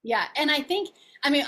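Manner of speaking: ordinary talk, nothing marked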